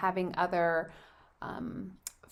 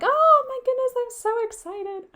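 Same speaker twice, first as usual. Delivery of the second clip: high excited squeal; 8 dB louder than usual. Retold or imitated. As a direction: gush with thrilled delight. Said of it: "I was just like".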